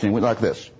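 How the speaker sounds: ordinary delivery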